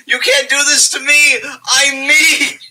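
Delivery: high, squeaky indignant protest. Retold or imitated. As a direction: pitch it way up in mock outrage. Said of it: "indignant enough ... to be like".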